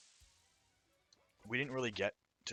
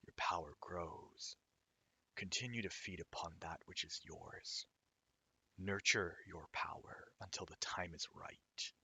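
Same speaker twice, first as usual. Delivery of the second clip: sinister whisper; 5 dB quieter than usual. Acted. Voices mysterious entity dialogue.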